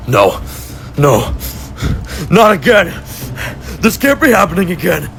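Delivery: panicked captive. Acted. Tom captured again, panicking.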